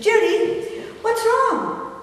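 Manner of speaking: high-pitched, startled concern; concerned mother. Retold or imitated. Mother hearing her daughter sobbing, concerned. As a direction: ask in a high, worried voice.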